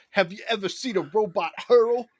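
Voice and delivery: high, loud brash robot voice; Alpha Six. Acted. Robot interrupts a heart-to-heart, loud high Brooklyn robot impression. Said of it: "the Alpha Six comes up and goes".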